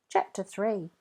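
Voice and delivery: ordinary delivery, nothing marked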